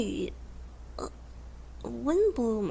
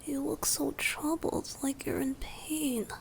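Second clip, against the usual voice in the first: concerned whisper; 4 semitones higher, 7 dB quieter than usual. Acted. Soft concern for listener.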